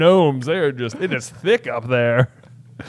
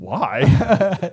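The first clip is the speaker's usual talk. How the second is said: pretend delighted dad; 6 semitones higher than usual. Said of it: "be like".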